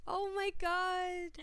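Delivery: high, slow, stretched-out disbelief; amused shock at the lost tea; drawn-out oh my God. Real, not acted. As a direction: drag it out in disbelief. Reacting to lost tea story.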